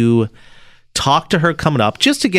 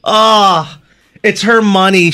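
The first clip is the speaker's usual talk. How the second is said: exasperated; 8 semitones higher, 6 dB louder, slower than usual. Real, not acted. Reacting to scam story.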